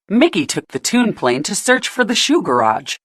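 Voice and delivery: ordinary delivery, nothing marked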